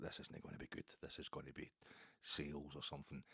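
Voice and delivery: hushed inner thought, almost a whisper; quiet wary thought at the door. Retold or imitated. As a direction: mutter it to yourself under the breath. Inner thought while answering door.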